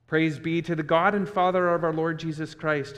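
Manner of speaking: normal talking voice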